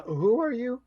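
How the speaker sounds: high, far guard challenge; suspicious guard. Acted. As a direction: bark it like a watchman. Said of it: "so they say".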